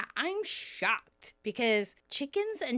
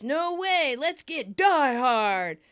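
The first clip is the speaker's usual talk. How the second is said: loud, raised mock enthusiasm; acted insistence; 2 semitones higher, 6 dB louder, slower than usual. Joey insists on his favorite movie, loud and eager. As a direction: push it louder, stretching the movie title.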